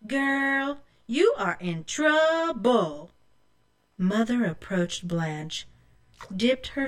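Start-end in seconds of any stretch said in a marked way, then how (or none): 0.1-3.1 drawn-out singsong taunt; taunting sister. A narrator's reading. Rose tells Blanche off, gloating. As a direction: stretch out the word trouble to taunt. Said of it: "Rose looked at Blanche and said".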